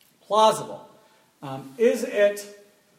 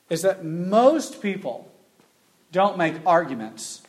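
loud, slow, stressing "don't make arguments"; slow emphatic stress; 7 dB louder, slower than usual. Shown as a demonstration. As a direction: lean hard on "don't". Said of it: "they don't make arguments".

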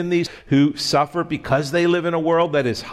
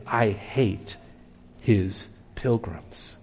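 lower, softer, slow hissed threat; monster hiss; 5 semitones lower, 6 dB quieter, slower than usual. Acted. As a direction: hiss it through the teeth like a beast. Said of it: "the monster hissed".

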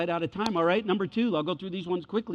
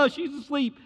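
high-pitched mimicking jeer; mocking; 6 semitones higher, 4 dB quieter than usual. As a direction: parrot the words back in ridicule. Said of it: "They mock him".

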